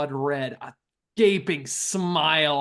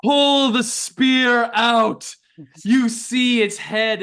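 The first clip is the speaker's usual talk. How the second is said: dramatic narration; 8 semitones higher, 7 dB louder, slower than usual. A narrator's reading.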